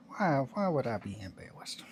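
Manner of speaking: whispered, half to himself; whispered aside. Real, not acted. Streamer whispers to chat, defensive. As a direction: breathe the words to chat.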